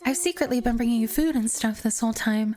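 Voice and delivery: put-on shy girl voice. Girl character voice.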